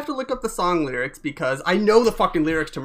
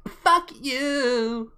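a mild punk snarl; punk singer imitation; 7 semitones higher than usual. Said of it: "That was actually an imitation".

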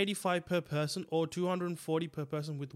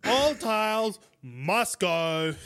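slow, loud TV ad announcer voice; Frank Walker ad voice; 3 semitones higher, 7 dB louder, slower than usual. Retold or imitated. Mocking the pushy guy as a TV tile ad, slow and loud. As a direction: drag out the sale pitch like a tile ad. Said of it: "Frank Walker from National Tiles".

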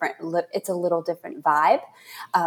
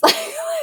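light laugh. Real, not acted. Host laughs at herself.

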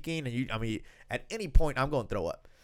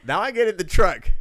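normal talking voice

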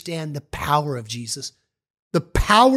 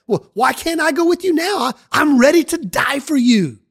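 higher, insistent pleading; eager protest; 7 semitones higher, 4 dB louder than usual. Acted. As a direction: Peter begging to come along. Peter protests, eager to follow.

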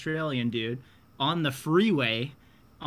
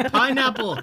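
laughing safe word; 11 semitones higher, 7 dB louder than usual. Acted.

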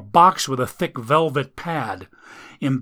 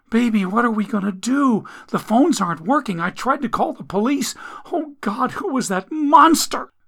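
high, rising frightened voice; terrified girlfriend asking about the monster; panicky fear; 9 semitones higher than usual. Acted. Amelia panicking.

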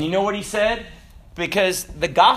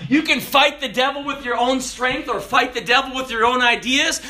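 normal talking voice

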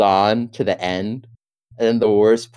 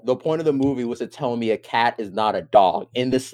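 normal talking voice

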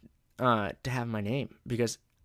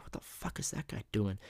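softer, under-the-breath disbelief; muttering to himself; 7 dB quieter than usual. Shown as a demonstration. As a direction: grumble privately while watching someone odd. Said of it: "I whisper, "What the fuck?" to myself".

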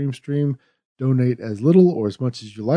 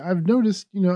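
usual speaking voice

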